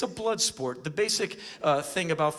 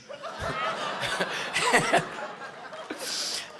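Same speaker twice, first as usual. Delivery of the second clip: high breathy giggle; 3 dB quieter than usual. Real, not acted. Laugh after the bit.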